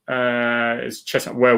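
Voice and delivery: normal talking voice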